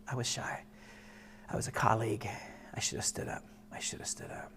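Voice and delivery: very low, near-whispered confession; hushed regret. Real, not acted. Father admitting he failed his first son, hushed regret. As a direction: sink into a quiet, regretful murmur.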